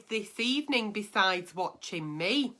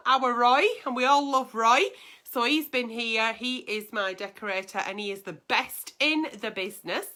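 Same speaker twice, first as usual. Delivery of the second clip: ordinary delivery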